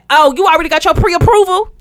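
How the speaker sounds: mock loud, high realtor; imitating a caller with no volume control; too loud caller. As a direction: demand it loudly down the phone. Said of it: "can you bring it down?".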